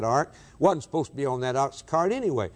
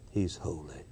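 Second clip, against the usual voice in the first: much lower and much softer; almost breathe it out; a fading reverent hush; near-whispered awe; 9 semitones lower, 12 dB quieter than usual. Real, not acted. Repeat fades further.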